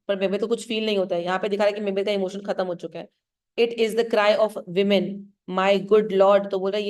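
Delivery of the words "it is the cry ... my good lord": slow recital. Shown as a demonstration. Teacher reads Seyton's line slowly.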